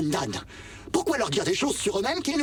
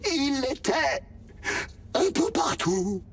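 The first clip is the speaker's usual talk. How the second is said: fading last words, half sobbing; breaking voice; 5 semitones higher than usual. Acted. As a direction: voice cracking on the punchline. Last joke of a dying machine.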